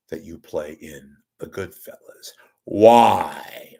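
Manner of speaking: slow, portentous interviewer with long pauses; Doctor Lipton. Acted. Lipton questioning Pesci about Goodfellas, slow interviewer impression. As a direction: draw out the question, land loud on WHY. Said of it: "That's how he asked it".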